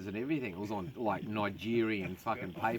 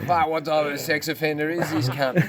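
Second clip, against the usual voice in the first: mock baffled cop; 5 semitones higher, 8 dB louder than usual. Retold cops, baffled outburst.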